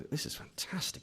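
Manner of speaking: very soft, breathy mock amazement; hushed awe. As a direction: marvel under your breath as an absorbed reader. Acting out a thrilled reader.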